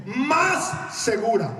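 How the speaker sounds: "más segura" high and very drawn out; emphatic proclamation. Acted. Preacher stresses verse for congregation, "más segura" slow and high. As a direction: stretch "más", then "segura".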